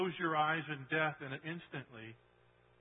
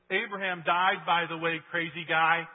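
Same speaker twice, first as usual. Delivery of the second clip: louder, mocking jab; loud sarcastic retort; 3 semitones higher, 9 dB louder than usual. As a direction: throw it back at him scornfully.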